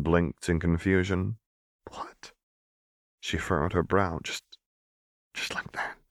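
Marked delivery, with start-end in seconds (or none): 1.9-2.3 stunned breathy whisper. Acted. Seraphine whispers in confusion to Renata. 4.2-6.0 halting stunned whisper. Acted. Seraphine whispers disbelief at Renata's offer.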